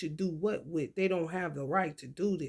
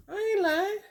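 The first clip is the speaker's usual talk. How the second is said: amused squeak; 13 semitones higher than usual. Real, not acted. Laughing aside after the joke.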